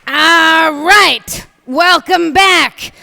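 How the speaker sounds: high, loud host address to the crowd; rally the room; loud welcome. Real, not acted. Comic greeting the audience, loud and high.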